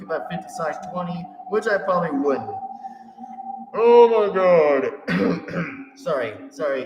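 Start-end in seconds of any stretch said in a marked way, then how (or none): 3.7-5.6 loud, very slow exclamation; long, loud stretched-out outburst; drawn-out exclamation. Real, not acted. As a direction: draw every word out loudly. Exclaims after laughing fit.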